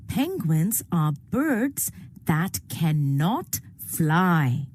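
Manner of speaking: ordinary delivery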